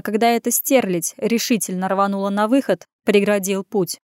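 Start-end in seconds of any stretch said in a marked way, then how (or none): none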